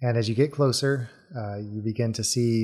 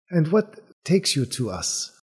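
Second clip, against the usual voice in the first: lightly voiced bird-man, higher pitch; bird-man character; 5 semitones higher than usual. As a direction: ask the newcomers with a bird-man's curiosity.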